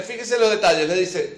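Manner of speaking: usual speaking voice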